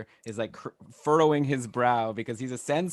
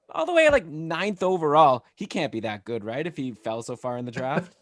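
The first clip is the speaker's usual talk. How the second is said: incredulous, high and loud at first; sarcastic; 4 semitones higher, 6 dB louder than usual. Real, not acted. Host goading panel about a draft pick, sarcastic. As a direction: needle them with sarcastic disbelief. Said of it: "I sense your sarcasm".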